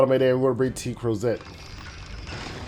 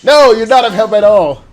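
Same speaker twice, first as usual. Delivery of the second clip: loud playful scoff; laughing put-down; teasing retort; 7 semitones higher, 9 dB louder than usual. Real, not acted. Answering a game character's line.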